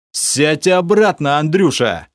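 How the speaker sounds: high, slow, commanding growl; growled order. A narrator's reading. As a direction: snap him back into his seat. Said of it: "рыкнул я". (рыкнуть приказ)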